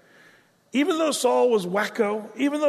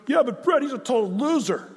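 objecting listener; 6 semitones higher than usual. Pretend.

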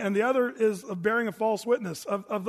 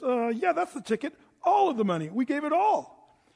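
lightly voiced fib; 6 semitones higher than usual. Sapphira lies to Peter.

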